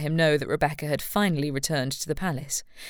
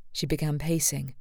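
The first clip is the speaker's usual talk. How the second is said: ordinary delivery, nothing marked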